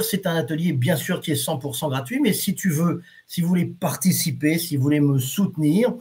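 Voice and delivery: usual speaking voice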